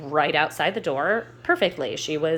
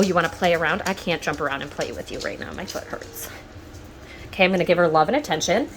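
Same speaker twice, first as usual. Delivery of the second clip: ordinary delivery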